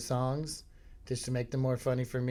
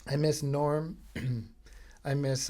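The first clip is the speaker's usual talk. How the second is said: ordinary talk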